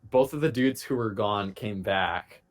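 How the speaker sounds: usual speaking voice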